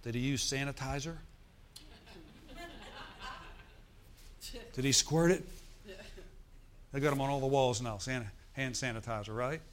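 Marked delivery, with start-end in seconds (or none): 0.0-5.5 mocking skeptic. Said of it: "Someone said".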